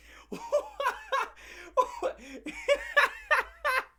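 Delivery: long shrieking cackle; high cackling laugh. Real, not acted. Cracking up at his own rant, high cackle. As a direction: burst into a high wild laugh.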